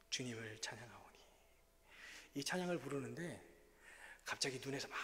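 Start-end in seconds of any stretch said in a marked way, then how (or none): 0.1-1.2 주님을 찬양하오니 whispered, unvoiced, falling away; whispered hymn line. Pastor repeating the hymn line in a whisper.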